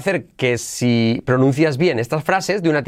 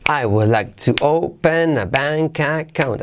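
slow repeat model; slower than usual. Shown as a demonstration.

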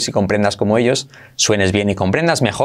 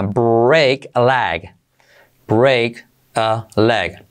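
very slow, each word apart; slow explained; much slower than usual. Shown as a demonstration. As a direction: lay out each word for learners. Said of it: "primero explicado lento". (despacio, palabra por palabra)

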